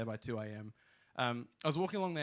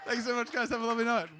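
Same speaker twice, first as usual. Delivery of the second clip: high, loud crowd-pumping farewell; big stage sign-off shout; 11 semitones higher, 12 dB louder than usual. Real, not acted. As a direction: sell the finish to the room. Comedian wraps set to crowd.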